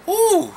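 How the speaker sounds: a loud high-pitched ooh. Real, not acted. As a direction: squeal like you're struggling to remember. Mock recall.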